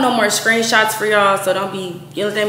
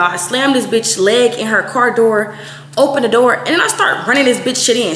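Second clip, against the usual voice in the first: usual speaking voice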